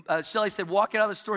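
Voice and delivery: normal talking voice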